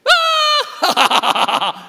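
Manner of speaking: high loud "Ah!" yelp; mock scream. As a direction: let out a hammed-up cry.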